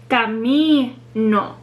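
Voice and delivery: "camino" drawn out slowly on MI; stress on second-to-last syllable. Shown as a demonstration. Teacher repeating for learners, slow stress on MI in "camino". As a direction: stretch MI in "camino", then a separate falling NO. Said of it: "second to last syllable is the one that's stressed".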